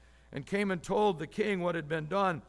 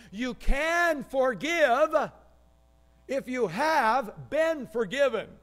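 You can forgive if you have been forgiven, slow, higher, can stretched and rising; slow emphatic statement; 5 semitones higher, 4 dB louder, slower than usual. Shown as a demonstration. Pastor answering his own question to the congregation, slow and emphatic. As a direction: slowly land the key phrase, leaning on can. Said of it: "What do I mean?".

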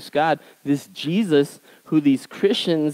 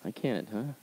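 God's quiet wry reply, softer; 13 dB quieter than usual. Retold or imitated. God answering a complaining believer, quiet and wry. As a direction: tease the complainer gently. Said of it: "God's going".

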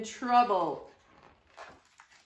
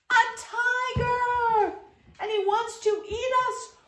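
shout; 12 semitones higher, 7 dB louder than usual. Acted. Tiger in treasure chest.

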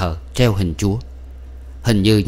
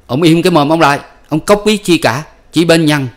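higher, louder hostile retort; nasty snap; 7 semitones higher, 5 dB louder than usual. A narrator's reading. Husband snapping at the adviser to shut up, nasty retort. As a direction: cut him off harshly. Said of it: "tôi ác giọng phủ đầu".

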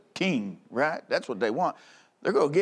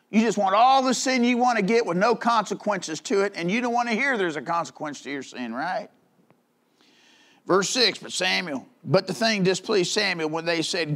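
usual speaking voice

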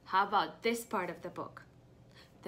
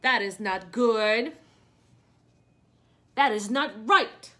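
indignant Gerald; 10 dB louder than usual. Acted. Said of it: "Who's saying that? Gerald".

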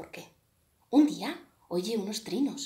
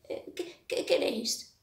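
stammering; 4 semitones higher than usual. A narrator's reading. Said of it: "dice Cuervo tartamudeando".

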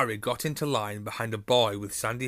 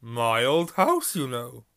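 jolly booming friar; 7 dB louder than usual. Acted. Friar continues.

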